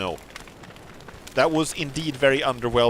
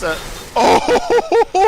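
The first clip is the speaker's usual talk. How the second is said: burst of laughter; 9 dB louder than usual. Real, not acted. Sudden mishap mid-sentence.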